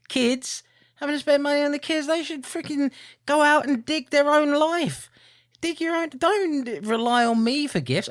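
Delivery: high-pitched comic exasperation, dragging out key words; mock rant. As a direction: rant in a high, put-upon voice. Mock rant about buying kids gifts.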